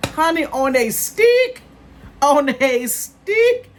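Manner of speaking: shocked viewers can buy chicken on a stick; incredulous. Real, not acted. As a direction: stretch it out in utter disbelief.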